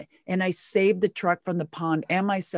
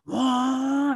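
drawn-out "wah" engine noise; imitated engine roar; 5 semitones higher than usual. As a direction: voice the truck lurching off. Imitating the truck taking off.

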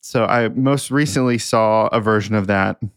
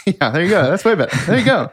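laughing; 8 semitones higher, 3 dB louder, faster than usual. Real, not acted. Laughing at partner's joke.

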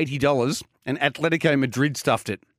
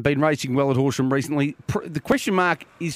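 normal talking voice

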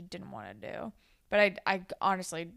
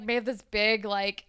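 normal talking voice

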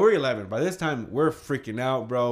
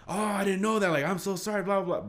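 mock apology; 8 semitones higher than usual. Cousin apologizing, mocked.

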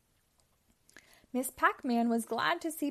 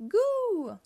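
put-on high, loud baby "goo"; baby gurgle; 12 semitones higher, 8 dB louder than usual. A narrator's reading. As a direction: let out a big high-pitched baby coo. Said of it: "Baby Pac-Man gurgled".

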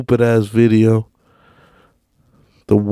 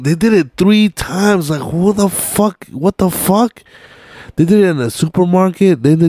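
incredulous rant; 8 semitones higher than usual. Real, not acted. Ranting at the repeated song.

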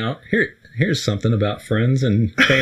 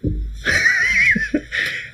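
shrieking belly laugh; high shrieking laugh. Real, not acted. Laughs at podcalypse joke.

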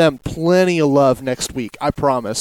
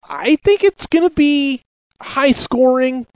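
emphatic; 6 semitones higher than usual. Real, not acted. Repeats prediction, second with emphasis.

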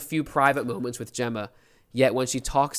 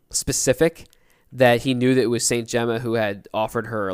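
normal talking voice

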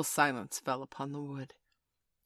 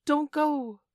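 high, loud plea; calling in pity; 12 semitones higher, 8 dB louder than usual. A narrator's reading. Boys calling after Toodles as he leaves, pitying. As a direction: call out pleadingly. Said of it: "they called in pity".

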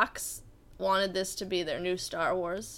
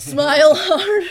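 loud director; 6 semitones higher, 13 dB louder than usual. Retold or imitated. Mocking a film director.

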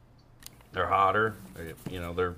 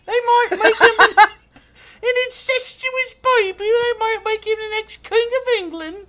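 very high, slow falsetto of a scandalized English lady; falsetto posh lady; 5 dB louder than usual. Pretend. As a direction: shriek it like a prim old English lady gasping. Mock posh British lady.

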